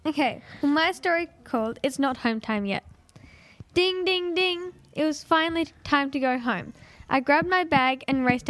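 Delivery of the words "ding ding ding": high, sing-out school bell dings; imitated bell. Pupil's story opens at home time, imitated bell. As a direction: chime it like a bell, pitched up.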